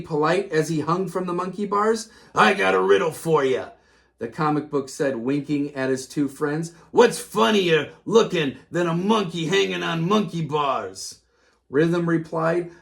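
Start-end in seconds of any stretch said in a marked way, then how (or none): 2.3-3.7 comic book bully. A narrator's reading. 6.9-11.2 comic book bully. A narrator's reading. Bully telling riddle.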